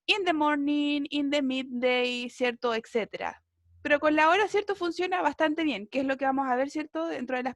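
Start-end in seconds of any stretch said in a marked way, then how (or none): 0.1-2.3 slow, deliberate English examples; slow model phrases. Shown as a demonstration. Teacher giving students example answers, slow and deliberate. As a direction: spell the phrases out slowly for learners. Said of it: "Ahí usted puede decir el periodo del día".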